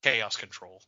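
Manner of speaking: low, soft, lifeless line read; deadpan it as if barely trying; mock an uninvested voice-over, dropped low and quiet; Keanu Reeves. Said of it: "this is literally how he says it".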